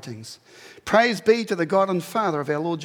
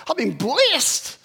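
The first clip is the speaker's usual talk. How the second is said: joyful; 8 semitones higher, 4 dB louder, much slower than usual. Retold or imitated. Paul's joy, slow high.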